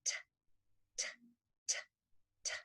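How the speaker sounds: "t" as spaced voiceless ticks; alarm clock ticking. Shown as a demonstration. Therapist imitating a clock, "t" as separate airy ticks. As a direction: tick "t" with the voice off, pausing between. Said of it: "alarm clock".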